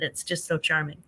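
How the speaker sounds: ordinary talk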